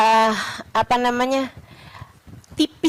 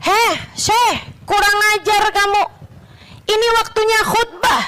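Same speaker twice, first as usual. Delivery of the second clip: scolding rebuke; 8 semitones higher than usual. Retold or imitated. Sheikh scolds man during sermon.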